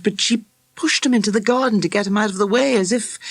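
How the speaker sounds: a hint of a troubled schoolboy puzzling it out; troubled boy. A narrator's reading.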